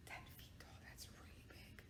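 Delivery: awed unvoiced whisper. A narrator's reading. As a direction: whisper it in awe. Whispered aside on size.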